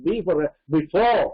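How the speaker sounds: "before" slowly, weight on fore; stress on second syllable. Shown as a demonstration. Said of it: "எங்க stress accent விழும்னா".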